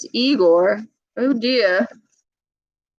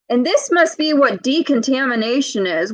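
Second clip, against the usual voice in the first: usual speaking voice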